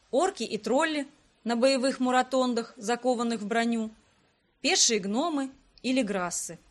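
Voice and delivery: ordinary delivery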